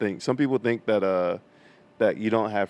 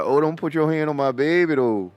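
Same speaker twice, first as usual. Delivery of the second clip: mock protective voice; 6 semitones higher than usual. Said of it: "He said".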